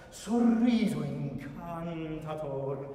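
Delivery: sorriso incantator slow, savoring ends; lingering sorriso incantator. Shown as a demonstration. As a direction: linger on the Italian endings. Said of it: "ends you have in Italian are so beautiful".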